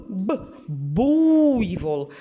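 syllable by syllable буйвол; slow sounding out. Shown as a demonstration. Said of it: "щоб чітко чути звук У".

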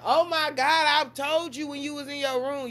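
high, loud whiny retort; exasperated daughter. Retold or imitated. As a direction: complain like an annoyed teen.

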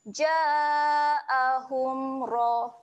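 slow drawn Ja'ahum ra with a thick ra; recited demo. Shown as a demonstration. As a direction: chant it slowly. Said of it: "bunyikan dengan ra".

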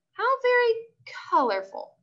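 high, prim polite remark; dry. A narrator's reading. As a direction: say it with cool politeness.

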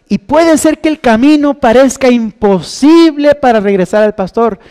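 high, loud call to respond; preaching at full force. Acted. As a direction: urge the listeners to answer. Llamado final de la predicación. (llamado ferviente, alto y fuerte)

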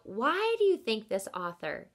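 usual speaking voice

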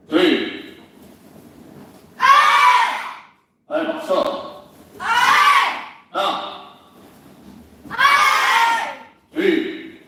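called-out martial arts drill commands; drill sergeant. Real, not acted. Counting kicks in drill.

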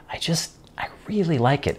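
breathy whisper of real fondness. Real, not acted. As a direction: whisper it, almost to yourself. Fond aside about the tool.

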